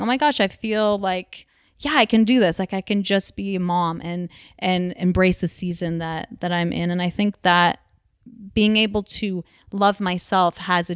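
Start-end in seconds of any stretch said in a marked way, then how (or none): none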